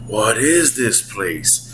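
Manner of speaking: soft, slow, low imitation; hushed Mario line. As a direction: half-whisper it slowly, imitating the film line. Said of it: "And he's whispering".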